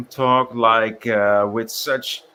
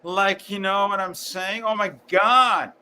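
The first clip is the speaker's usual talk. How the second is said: exasperated; 8 semitones higher than usual. Real, not acted. Exasperated about filler words.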